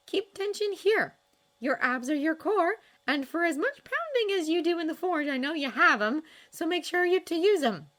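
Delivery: brisk trainer voice, patient but firm; Astrid coaching. A narrator's reading. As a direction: coach him like a confident warrior girl. Astrid coaching Hiccup's stance.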